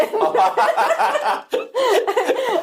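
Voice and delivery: high bursting laugh; real laugh. Real, not acted. Laughing at the dentist's meditation joke. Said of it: "in a very joking way".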